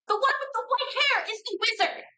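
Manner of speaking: high, fast falsetto screech; screaming puppet. Acted. Cracked-faced puppet accuses another, high rapid scream. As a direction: squeal it quickly in a tiny puppet voice. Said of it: "the side of its face is screaming".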